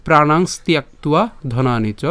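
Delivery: slow, clear chant of the shloka; slow verse recitation. Shown as a demonstration. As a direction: recite each syllable carefully for learners. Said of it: "আবার বলছি লাইনটা". (ধীরে স্পষ্ট শ্লোক পাঠ)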